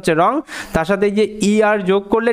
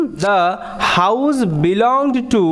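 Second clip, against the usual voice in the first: each word drawn out very slowly; slow classroom read-aloud; slow, clear read; 2 semitones lower, much slower than usual. Shown as a demonstration. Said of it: "এখানে দেখো".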